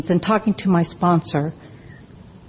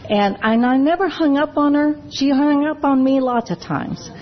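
ordinary talk

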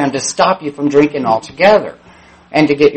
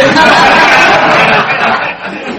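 a real, high burst of laughter; big laugh; 10 semitones higher, 7 dB louder than usual. Real, not acted. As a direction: crack up at your own line. Laughs at his own joke.

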